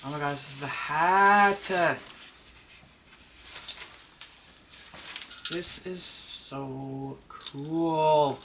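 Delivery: thrilled to finally hold the hat; excited gasp. Real, not acted. Unboxing the new doll hat.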